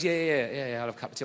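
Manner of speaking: a hint of the builder drawling yes; geezer voice. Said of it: "He goes".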